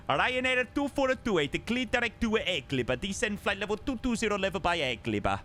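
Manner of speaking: high-pitched Italian controller voice; Franco's Italian accent. Acted. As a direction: put on an Italian accent for the clearance. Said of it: "Why do I put on the Franco accent".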